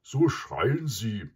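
scornful, drawn-out animal voice; contemptuous. A narrator's reading. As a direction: draw out the disdain as the buffalo. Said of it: "sagte Mysa verächtlich".

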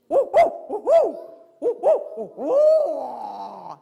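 loud, high hoots with a long falling last note; barred owl hoot. Shown as a demonstration. Handler demonstrating the barred owl's crazy call, loud high hooting. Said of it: "guys with the really crazy sounds".

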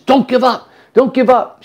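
high, insistent cheer of encouragement; excited, repeated rallying cry; retold urging. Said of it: "She kept on saying that".

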